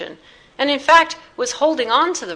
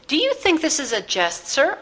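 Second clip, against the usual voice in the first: higher-pitched affronted question; indignant challenge; 7 semitones higher than usual. A narrator's reading. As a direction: challenge the man for laughing at you. Blake lunges at chuckling Astley.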